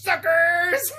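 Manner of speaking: high, loud gloating yell; mock-taunt with a sneer; hammed-up villain cry of "suckers". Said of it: "And he goes".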